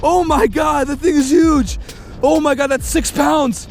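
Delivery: very high, loud squealing disbelief, slow on the biggest words; can't believe it, voice stuck way up high; disbelieving squeal. Real, not acted. Angler holds the huge bass, real squealing disbelief.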